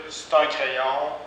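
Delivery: "c'est" reduced to "st" before "un"; spoken st for c'est. Shown as a demonstration. Teacher models reduced "c'est un crayon". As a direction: drop the vowel: "st un crayon". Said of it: "c'est possible de dire".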